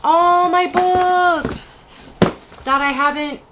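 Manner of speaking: slow, loud and high; dramatic reveal. Real, not acted. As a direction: draw it out dramatically.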